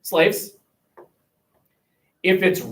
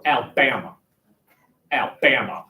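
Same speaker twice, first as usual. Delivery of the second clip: Southern Alabama; 6 semitones lower than usual. Shown as a demonstration. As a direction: say Alabama like a Southerner, low and slurred. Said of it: "You say it like a Southerner".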